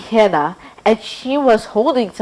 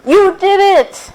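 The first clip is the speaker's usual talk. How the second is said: high, slow triumphant praise; cheering; 10 semitones higher, 6 dB louder, slower than usual. Acted. Mary cheering Rudy after her first prayer. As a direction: celebrate your friend's win.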